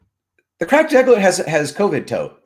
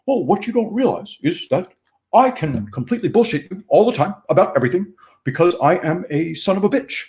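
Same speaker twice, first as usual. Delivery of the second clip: higher, put-on voice of a smug rich boomer; Scott Adams impression; 5 semitones higher than usual. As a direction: play a lying rich jerk, rising to a higher yell at the end. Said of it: "we need toilet brush impersonation".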